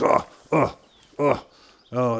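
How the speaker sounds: hammed-up sudden excitement; mock excited oh. Boss suddenly eager, showing off.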